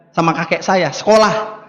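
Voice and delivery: ordinary talk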